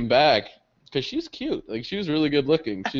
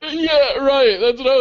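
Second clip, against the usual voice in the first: much higher and louder, giddy; excited yeah; 7 dB louder, slower than usual. Real, not acted. Storyteller agreeing with his cohost about the woman, excited. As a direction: blurt it out thrilled.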